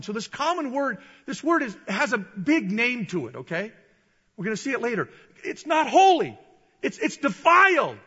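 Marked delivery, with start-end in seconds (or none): 5.4-8.0 loud, high emphatic disgust; emphatic rejection. Acted. Peter rejecting defiled food, loud emphatic refusal. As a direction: reject it forcefully, building in bursts.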